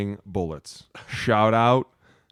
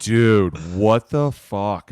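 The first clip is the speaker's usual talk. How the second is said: retold shocked outburst; 7 dB louder, much slower than usual. Waking up after surgery, shocked.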